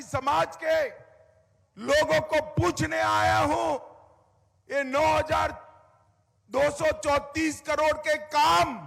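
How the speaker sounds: loud high rally oratory; booming political rally speech. Real, not acted. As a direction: rouse the crowd, full force. Rally speech at full force.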